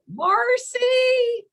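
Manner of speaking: high, loud, drawn-out summons; boss calling out. Retold or imitated. Justice summoning her clerk from her office, called out high and loud. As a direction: sing out a name to fetch someone from another room.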